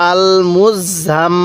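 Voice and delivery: "আল মুজাম" very slow, final m held long through the nose; ghunnah. Shown as a demonstration. Teacher showing learners the obligatory ghunnah, slow nasal hold. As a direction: draw out "আল মুজাম", humming on the last m. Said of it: "এবার লাগিয়ে গুনাহ করব".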